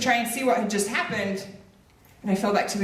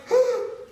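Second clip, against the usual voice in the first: gasp. Retold or imitated. Seeing hammer in leg.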